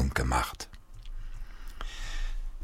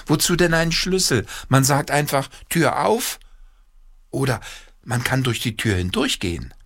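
put-on high, eager child's voice; excited boy with a new idea; inventive boy; 8 semitones higher than usual. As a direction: spin the idea out, pitched high. Peter tops father's idea.

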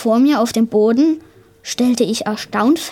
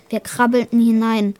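plain reading voice

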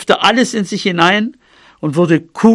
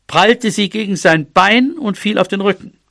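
ordinary delivery, nothing marked

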